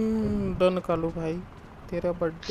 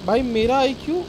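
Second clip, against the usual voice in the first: higher, louder, heated bragging; boastful rant to teammates, louder and higher; 4 semitones higher, 6 dB louder than usual. Real, not acted. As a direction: crow about your own smarts, loud and pitched up. Bragging about his game sense.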